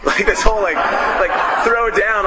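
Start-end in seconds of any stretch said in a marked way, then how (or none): none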